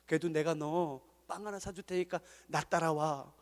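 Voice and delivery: soft, low kindly voice; caring talk. Shown as a demonstration. Pastor showing how to look after youth, soft and kind. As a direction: care for them quietly. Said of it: "성도님들을 돌아봐 주세요".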